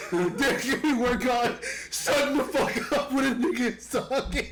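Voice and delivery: high, laughing complaint. Real, not acted. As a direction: scold while laughing. Laughing rant at friends.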